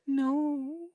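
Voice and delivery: high whining protest; whiny no. Retold or imitated. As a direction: whine it like a clingy flirt. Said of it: "she's like".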